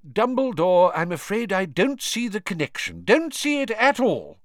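Fudge. A narrator's reading. Minister Fudge voiced.

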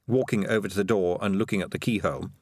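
usual speaking voice

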